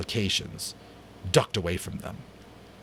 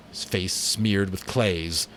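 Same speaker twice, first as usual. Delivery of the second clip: usual speaking voice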